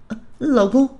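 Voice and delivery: high-pitched cry of surprise; startled cry. Acted. Pregnant woman spotting her husband, startled high cry. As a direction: call out, pitched far up. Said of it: "孕妇冲拳击冠军喊道".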